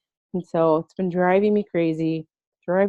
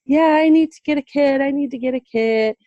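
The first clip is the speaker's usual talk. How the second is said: lightly mimicking friend; 7 semitones higher than usual. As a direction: imitate her breezy promise. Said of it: "she's always like".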